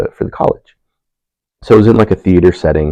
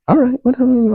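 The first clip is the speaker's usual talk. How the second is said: high dismissive shrug. Retold or imitated. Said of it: "I say".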